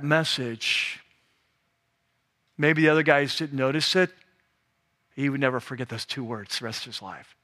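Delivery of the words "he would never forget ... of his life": hushed, intimate. Acted. Sermon climax.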